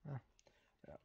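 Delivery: normal talking voice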